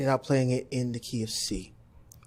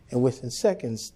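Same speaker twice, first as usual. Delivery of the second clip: usual speaking voice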